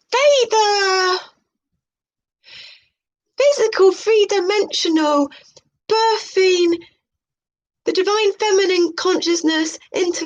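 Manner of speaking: high, airy parody of a mystic presenter; mock spiritual host. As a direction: announce it like a dreamy new-age show host. High mocking gloss on tarot text.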